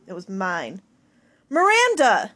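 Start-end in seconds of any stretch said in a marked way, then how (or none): 1.5-2.3 high, loud call across the house; shouting a name. Acted. Mom catching daughter in pantry, loud shout. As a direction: yell her name from the doorway.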